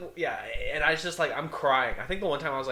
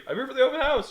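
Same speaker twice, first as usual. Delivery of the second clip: high chirpy mock-innocent retelling; mock innocent reply; 9 semitones higher, 5 dB louder, faster than usual. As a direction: play dumb, sweetly high. Retelling his reply again.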